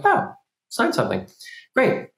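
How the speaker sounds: high, airy and carefree; casually unbothered. Pretend. A person shrugging at the courier's request, high and airy. Mock inner reaction to courier.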